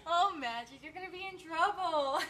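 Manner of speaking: high, loud, helpless laughter; laughing tease. Real, not acted. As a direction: tease them while cracking up. Teasing viewer while laughing.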